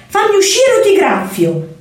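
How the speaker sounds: a small kitten trying to sound dangerous; trapped kitten threatening to scratch. Acted. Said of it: "miagolò lui minaccioso".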